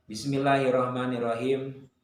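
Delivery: ordinary talk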